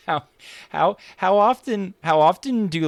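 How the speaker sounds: high and loud, breaking into a laugh on "how often"; laughing question. Real, not acted. Host laughing as he asks the guest about Kiwis, high and loud. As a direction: ask through your own laughter, rising up high.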